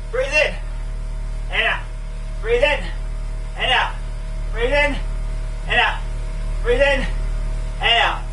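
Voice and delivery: rising on in, dropping on out; breathing coach cues. Real, not acted. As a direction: count the breaths like a trainer. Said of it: "coaching breathing cues".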